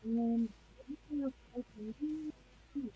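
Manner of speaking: muffled, as if underwater; garbled bad mic sound; underwater gurgling voice. Real, not acted. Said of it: "You sound like you're underwater".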